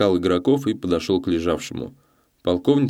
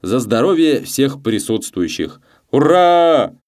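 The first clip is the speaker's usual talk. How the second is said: slow toast building to a loud cheer; dashing toast; 6 semitones higher, 6 dB louder, slower than usual. A narrator's reading. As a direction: proclaim grandly, then bellow the hurrah high. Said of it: "молодецкий провозгласил".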